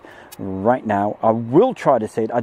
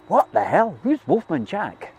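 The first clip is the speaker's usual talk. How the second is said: mock youngster; 8 semitones higher than usual. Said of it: "The youngsters are probably going".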